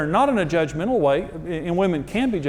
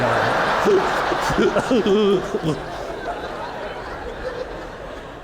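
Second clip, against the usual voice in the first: higher, breathy stretch of real laughter; 5 semitones higher than usual. Real, not acted. Pastor laughing with the crowd at his tearful-man bit. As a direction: crack up at your own joke. Speaker laughs at own joke.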